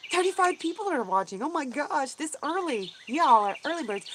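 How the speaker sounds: high-pitched, astonished exclamation; amazed. Real, not acted. Streamer seeing thirty-five people watching early, amazed. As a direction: gush at the turnout in real surprise.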